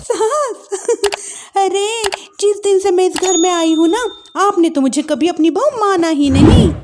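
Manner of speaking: mild scornful outburst; angry young wife. Acted. Wife argues with mother-in-law.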